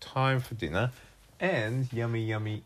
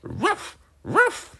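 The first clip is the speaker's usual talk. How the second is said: high, loud storybook bark; two sharp yappy woofs; dog bark; 7 dB louder than usual. Acted. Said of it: "barked Shaggy Dog".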